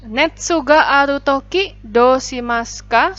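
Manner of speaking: "Netsu ga aru toki, dou shimasu ka?" slow and loud, rising on "ga" and "dou"; model intonation. Shown as a demonstration. Teacher loudly models the question's intonation for the class. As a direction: lift "ga" and "dou" slowly, loud and clear. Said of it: "silahkan diperhatikan intonasinya ya".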